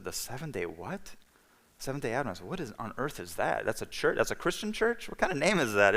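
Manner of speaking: softly puzzled questions, climbing in pitch; retold bafflement. Reacting to friend's odd church name, baffled disbelief. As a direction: query it as if it makes no sense. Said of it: "It just seemed really weird to me".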